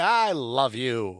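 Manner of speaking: slow, drawn-out mock devotion; mocking lovestruck line; Connie. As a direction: stretch out a silly declaration of love. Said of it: "she's like".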